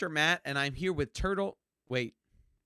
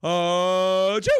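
fake sneeze; 8 dB louder than usual.

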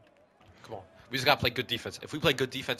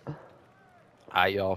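usual speaking voice

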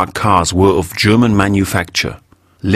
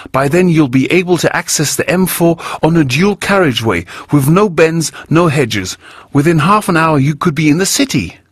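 much higher, emphatic woman's voice; emphatic Elvira; 9 semitones higher than usual. A narrator's reading. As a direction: press every word with conviction. Elvira rails against urban sprawl.

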